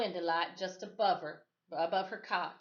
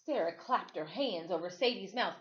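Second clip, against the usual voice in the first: plain reading voice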